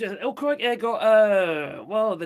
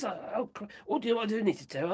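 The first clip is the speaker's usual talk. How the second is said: ordinary talk, nothing marked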